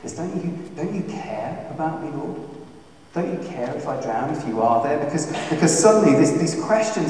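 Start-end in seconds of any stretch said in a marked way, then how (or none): 0.1-5.0 soft aching question to God; hushed prayerful plea; quiet plea. Retold or imitated. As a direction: ask it softly, wounded. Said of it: "any sane person asks".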